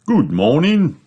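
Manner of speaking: lifted, sing-song welcome; beaming shopkeeper. Acted. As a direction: greet a customer with a big beam. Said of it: "beams Mister Fox".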